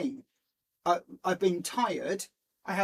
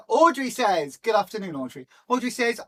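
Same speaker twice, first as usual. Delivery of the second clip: ordinary talk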